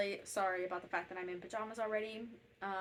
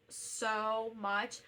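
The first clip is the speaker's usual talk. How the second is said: normal talking voice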